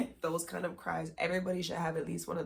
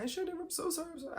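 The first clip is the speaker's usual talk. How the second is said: high-pitched mock tearful apology; imitated crying freak-out; 12 semitones higher, much faster than usual. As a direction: imitate him babbling sorry in a high voice. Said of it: "while he's crying and freaking".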